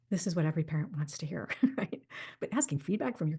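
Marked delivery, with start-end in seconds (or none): none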